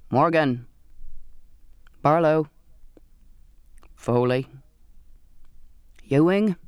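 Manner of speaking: single names in lightly varied voices; each name a separate sailor, set apart by long pauses; sailors' roll call. A narrator's reading. Sailors step forward one by one to the mate, lightly voiced roll call.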